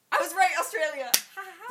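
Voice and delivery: loud, high triumphant crow; triumphant gloating. Real, not acted. Player crowing at co-host over a quiz answer, gloating. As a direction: crow over a win with a mock laugh.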